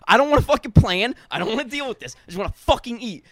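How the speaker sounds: high, loud fed-up rant that eases off; blowing up over a dinner change, loud then settling; angry rant. Retold or imitated. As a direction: vent hard, then run out of steam. Retold blowing up.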